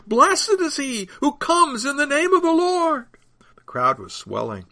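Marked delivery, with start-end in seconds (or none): 0.1-3.1 high, loud acclamation; shouted blessing. A narrator's reading. Crowd blessing the coming king, loud high shout. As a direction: proclaim it high over the crowd. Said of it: "shouted another".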